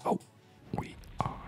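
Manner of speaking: usual speaking voice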